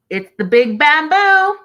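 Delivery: high, loud, rising to the last word; gleeful proclamation. Real, not acted. Host echoing what Andrea said, gleeful proclamation. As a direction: belt out the punchline, climbing in pitch. Gleefully repeating a viewer's phrase.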